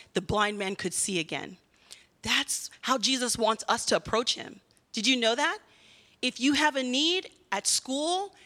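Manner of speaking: normal talking voice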